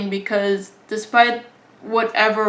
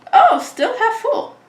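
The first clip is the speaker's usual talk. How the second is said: surprised; 13 semitones higher than usual. Pretend. Imagined find in the rubble.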